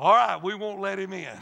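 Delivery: playful retold shout; gleeful mock agreement. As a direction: burst out in hammed-up, rousing agreement.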